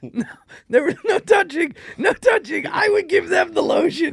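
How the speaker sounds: high-pitched, alarmed denial; frantic protest. Real, not acted. Teacher defending himself over the lotion story, high frantic protest. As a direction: insist at the top of your range.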